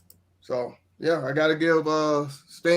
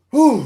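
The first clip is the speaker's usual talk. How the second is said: relieved whew; 10 semitones higher, 11 dB louder than usual. Real, not acted. Relief after picking number two.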